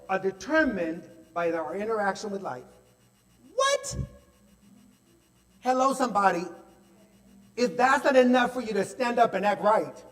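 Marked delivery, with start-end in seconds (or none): 3.6-4.0 high, shocked exclamation; astonished what. Real, not acted. 5.6-10.0 loud, higher call to the congregation; preaching. Real, not acted. Preaching to the crowd.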